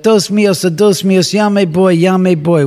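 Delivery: high, loud panicked cry in Spanish; retold scream. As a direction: yell it out in terror, mimicking the worker. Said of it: "And he screams out".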